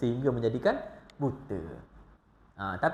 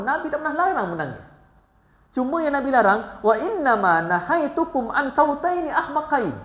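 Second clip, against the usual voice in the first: high, driving preacher's voice; fervent preaching; 9 semitones higher, 4 dB louder than usual. Acted. Preacher urging the congregation to weep freely, fervent. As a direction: preach it out to the hall.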